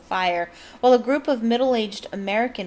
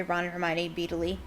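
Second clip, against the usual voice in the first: ordinary delivery, nothing marked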